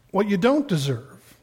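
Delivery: normal talking voice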